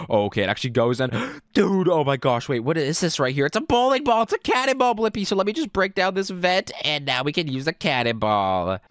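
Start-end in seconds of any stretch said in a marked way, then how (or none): 3.5-5.3 high-pitched excited gamer find; excited discovery. Real, not acted. Gamer showing Blippi a cannonball, excited. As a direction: blurt it out higher, thrilled.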